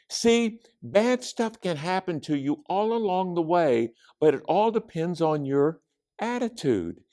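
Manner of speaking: put-on high, animated young girl voice; Judy explaining her game. A narrator's reading. Judy explains the board game to friends, high and eager. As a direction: pitch the rules up high and eagerly.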